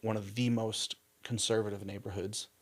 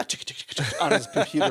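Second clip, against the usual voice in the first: laughing typing sounds; 7 semitones higher, 9 dB louder than usual. Retold or imitated.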